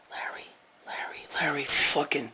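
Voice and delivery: barely audible mutter, trailing into a whisper; muttering to himself. Real, not acted. Host muttering to himself, straining to recall a name, hushed. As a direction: search for the name under your breath.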